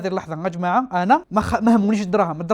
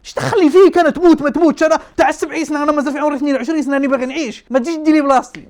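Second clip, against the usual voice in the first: loud, high-pitched protest; indignant rant; 5 semitones higher, 5 dB louder than usual. Retold or imitated. Young patient protesting to old man taking his turn, indignant. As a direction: argue your case, raised and worked up.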